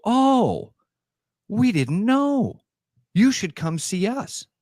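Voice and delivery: high, slow, sly put-on voice; toy makers. Toy makers lure the man over, high and slow. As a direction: draw him in sweetly. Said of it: "And they go".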